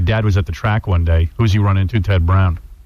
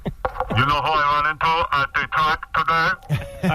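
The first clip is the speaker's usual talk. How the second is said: put-on old father voice; 6 semitones higher, 5 dB quieter, slower than usual.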